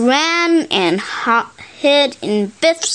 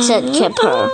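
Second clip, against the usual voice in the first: ordinary delivery, nothing marked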